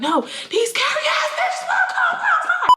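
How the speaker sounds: very high, slow drawn-out whine; hammed-up outraged squeal; mock screaming. Girl complaining to dad about them, mock shrieking.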